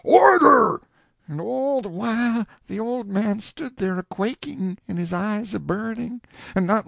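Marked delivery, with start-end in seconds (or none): 0.0-0.8 high, loud bellow for order; sheriff yelling. A narrator's reading. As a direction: yell over the uproar. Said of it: "the sheriff yelling".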